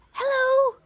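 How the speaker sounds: put-on high, loud boyish call; shouting up. A narrator's reading. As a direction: call up to someone towering above. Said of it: "Jack shouted up".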